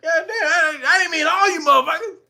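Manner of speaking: high, laughing panicked shouts; panicked mock mayor. Streamer mocks the mayor panicking at too many arrivals, high and laughing. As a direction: act swamped and backpedaling.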